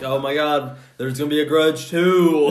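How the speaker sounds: lightly hammed-up shock; mock plot-twist excitement. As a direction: announce it like a big reveal. Voicing a reaction to plot twist.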